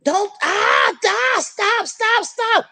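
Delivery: high mock screams of "stop"; woman being stabbed on the phone, imitated screaming; retold screaming. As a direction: imitate the victim crying out, pitched high. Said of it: "is her screaming".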